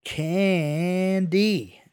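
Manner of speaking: CANDY stretched out very slowly and high; labored. A narrator's reading. Johnny labors the word for blank-faced Julio, drawn out. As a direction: drag out both syllables of Candy, overly clearly. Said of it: "Johnny labored".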